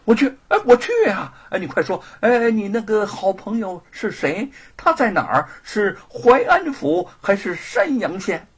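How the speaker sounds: eager, tumbling questions; keen, exclaiming acceptance; excited. Acted. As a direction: jump at the offer, greedy for details. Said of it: "狱卒兴奋地说".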